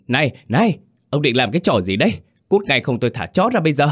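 high, fast, agitated woman's outcry; panicked yelling. A narrator's reading. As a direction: yell a panicked threat to drive him off. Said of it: "Mụ hoảng hốt la toáng lên". (la toáng lên hoảng hốt)

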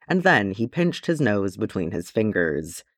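ordinary delivery, nothing marked

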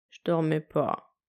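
slow, low, half-awake denial; sleepy protest. A narrator's reading. Husband caught dozing denies it, slow sleepy protest. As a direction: insist you weren't asleep, drowsily. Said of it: "protesta-t-il".

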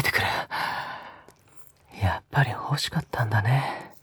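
breathy close-mic whispers with a teasing edge; a lover's hushed, teasing murmur; intimate ASMR whisper. Acted. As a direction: whisper right into the listener's ear, coaxing. Intimate whispered roleplay throughout.

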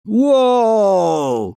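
long high falling "whoa"; drawn-out high cry; swinging whoop. Acted. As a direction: whoop as if flying on a vine. Acted cry while swinging on vine.

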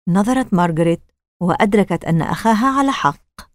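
plain reading voice

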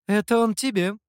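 usual speaking voice

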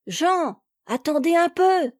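put-on high, bright call of a little girl; joyous little girl calling. A narrator's reading. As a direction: call out like a happy ten-year-old girl. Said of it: "cria ... une joyeuse voix de petite fille".

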